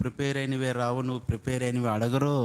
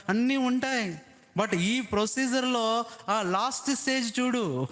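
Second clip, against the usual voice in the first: high, ringing sermon voice; fervent preaching; 9 semitones higher than usual. Acted. Pastor exhorting the church to keep the procedure, fervent. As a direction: preach it with rising fire.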